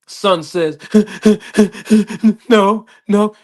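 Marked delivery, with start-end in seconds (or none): 0.8-2.4 high mock sob of the son; a child's sob imitated. Son sobbing before answering. 2.5-3.4 high, louder sobbing "no"; mock tearful child's denial; crying child. Crying son answers dad.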